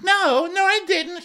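loud, very high mock denial; Jennifer. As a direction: squeal the denial in caricature. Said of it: "She's like".

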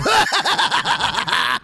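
high cackling clown laugh; evil clown laugh. Pretend. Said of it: "like an evil clown laugh".

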